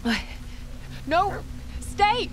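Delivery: sudden high yell; panicked shout. Acted. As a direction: scream for the dog to stay.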